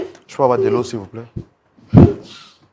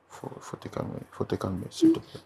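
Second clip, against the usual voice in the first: hushed soothing; 8 dB quieter than usual. Real, not acted. Helper telling the crying woman to calm down, hushed soothing. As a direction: murmur it to calm someone crying.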